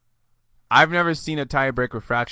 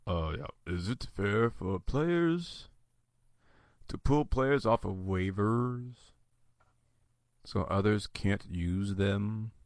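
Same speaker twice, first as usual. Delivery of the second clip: low, soft, drawn-out creaky voice; vocal fry growl; 4 semitones lower, 7 dB quieter, slower than usual. Shown as a demonstration. Host reading out a waivers question, in a drawn-out vocal fry. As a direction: stretch the words out in a slow, quiet growl. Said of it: "when people talk like this".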